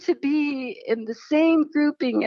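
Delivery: laughing through the words, pitch high; laughing with joy. Retold or imitated. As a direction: giggle it out, delighted. Laughing through retold prayer.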